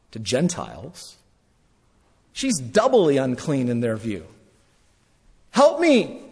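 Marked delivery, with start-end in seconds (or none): 5.5-6.2 high, loud desperate cry; shrill cry of a desperate mother; crying for help. Acted. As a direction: scream for help as the begging mother. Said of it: "Hear the cry?".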